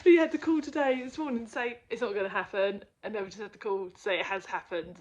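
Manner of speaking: loud, breathless rush of news; excited. Real, not acted. Telling viewers the sale finally went through, elated. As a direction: blurt it out overjoyed. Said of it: "I'm so friggin' excited".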